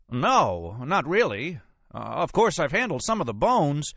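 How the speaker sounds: high-pitched, flustered examiner; flustered defence. Acted. Examiner nervously defends himself.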